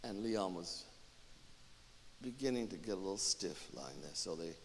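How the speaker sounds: soft, low, sorrowful telling; hushed narration. A narrator's reading. Narrator as she cleans his body, hushed and low. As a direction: tell it quietly, with long pauses.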